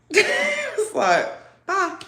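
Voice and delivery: high laughing mock farewell; high squeaky laughing bye. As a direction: giggle out the bye. Laughing imitation.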